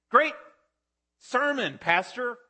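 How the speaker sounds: high, cheery compliment to the pastor; pleased churchgoer. Pretend. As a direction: gush like a satisfied listener.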